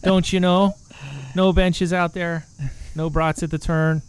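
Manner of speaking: high, drawn-out mock Midwestern; Midwestern accent. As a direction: grumble as a caricatured Midwesterner. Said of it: "plenty of Midwesterners say that".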